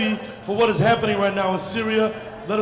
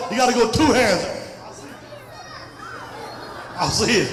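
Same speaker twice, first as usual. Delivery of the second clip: ordinary delivery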